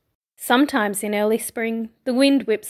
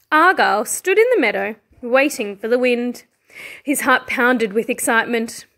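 usual speaking voice

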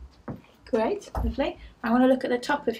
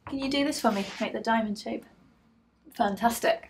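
ordinary delivery

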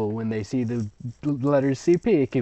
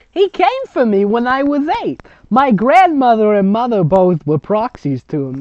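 high, loud hammed-up old woman; grandmother storyteller; 9 semitones higher, 9 dB louder than usual. Grandmother telling the girls her Slender Man story, hammed-up high. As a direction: ham up the grandma boasting of her past. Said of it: "That's the grandmother".

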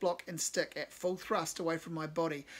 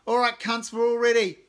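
gruff call; 6 semitones higher, 13 dB louder than usual. Acted. Warren Ellis addressing band.

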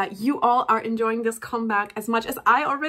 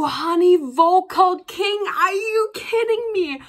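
1 excited gushing; 5 semitones higher, 4 dB louder, slower than usual. Real, not acted. Fan raving over her bias's vocals.

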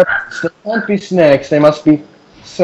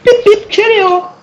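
silly high voice. Pretend.

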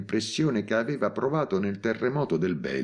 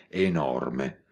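ordinary delivery, nothing marked

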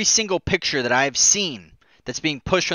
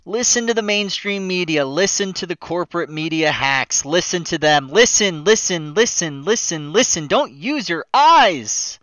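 mocking voice; 6 semitones higher, 6 dB louder than usual. Mocking opponents in a hammed-up voice.

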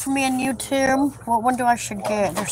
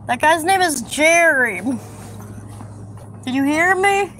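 excited telling; 5 semitones higher than usual. Real, not acted. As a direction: burst out with the news.

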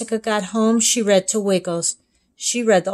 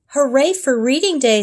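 higher, louder cheer; cheering dad; 7 semitones higher, 5 dB louder than usual. Acted. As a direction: celebrate loudly. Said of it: "said Dad".

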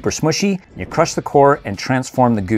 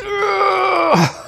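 high laugh. Real, not acted. Vlogger cracking up at own joke, high laugh. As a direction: burst of high-pitched laughter.

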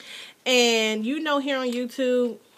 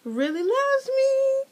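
gushing squeal; 14 semitones higher than usual. Real, not acted. Gushing about friend's gift.

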